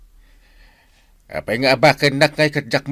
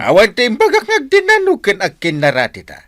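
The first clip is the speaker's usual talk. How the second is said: louder, higher indignant protest; angry correction; 5 semitones higher, 6 dB louder than usual. Acted. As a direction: snap back to set it straight. Captain angrily correcting misunderstanding.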